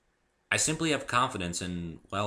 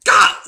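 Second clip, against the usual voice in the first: loud cry of pain; pained shout; 19 dB louder than usual. Acted. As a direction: shout out as the pain strikes again. Second stab of pain.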